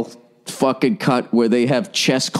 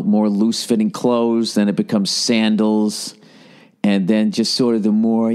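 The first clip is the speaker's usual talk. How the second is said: ordinary talk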